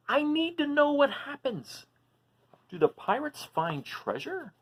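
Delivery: long for the ending like a last sip; pleading dragon voice. Acted. Reading aloud to kids, voicing Duncan's pleading wish.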